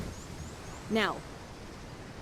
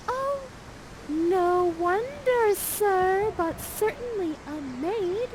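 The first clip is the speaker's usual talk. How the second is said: much higher, slow and demure; coy Miranda; 10 semitones higher, much slower than usual. Acted. Miranda answering Ferdinand, much higher and slow. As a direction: draw out each word shyly like a smitten maiden.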